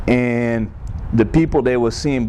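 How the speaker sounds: normal talking voice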